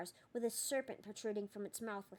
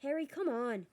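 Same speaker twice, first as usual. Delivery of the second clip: high, louder urging; urgent plea; 5 semitones higher, 5 dB louder than usual. A narrator's reading. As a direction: pull him away by voice. Hermione urging him again.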